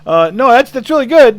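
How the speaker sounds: a timid sound engineer trying to stay polite; nervous young engineer. Retold or imitated. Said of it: "the poor kid, he goes".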